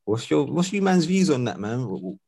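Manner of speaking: normal talking voice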